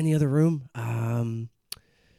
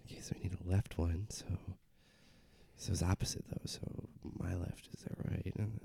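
very low, soft near-whisper; hushed, halting working-it-out; muttering to self; 8 semitones lower, 14 dB quieter than usual. Acted. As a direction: think aloud under your breath. Doctor works it out to themselves.

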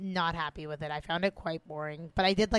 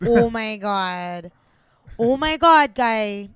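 Italo-Canadian accent; 12 dB louder, much slower than usual. Shown as a demonstration. Woman demonstrating her accent to co-host, loud Italo-Canadian accent. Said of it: "That's my, that's my Italo-Canadian accent".

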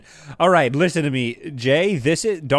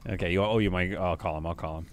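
quiet aside; 7 semitones lower, 8 dB quieter than usual. Real, not acted. Quieter asides before the call.

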